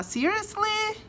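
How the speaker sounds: very high shocked Seriously; indignant disbelief. Shown as a demonstration. Reacting to something stupid, high indignant disbelief. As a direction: rise it up in outraged disbelief. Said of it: "se alguém diz, ah, alguma coisa estúpida".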